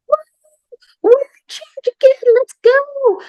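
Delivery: very high, loud squealed celebration; mock triumphant cheering. Vampires storm the field, high-pitched hammed-up cheering.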